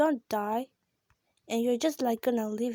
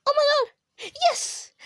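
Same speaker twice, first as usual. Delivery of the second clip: much higher, louder crying gasp of joy; ecstatic gasp; 14 semitones higher, 5 dB louder, slower than usual. Real, not acted. Kid thrilled by a game reward, ecstatic squeal. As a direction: squeal it in disbelief.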